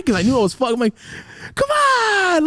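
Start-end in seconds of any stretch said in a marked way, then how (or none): 1.5-2.4 high, loud, crying whine; desperate pleading. Acted. Son begging dad on the phone, wailing plea. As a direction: wail it as a hammed-up beg. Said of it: "I was trying to plead".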